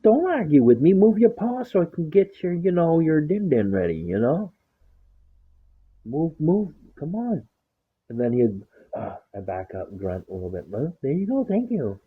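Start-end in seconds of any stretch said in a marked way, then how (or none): 0.0-7.5 high-pitched sing-song scolding of the dog; mock scolding baby talk. Owner scolding her dog at his food bowl in high baby talk. 10.7-12.0 high, lightly sweet thank-you; mock thank-you. Owner thanking the dog for moving his paw in a high chirp.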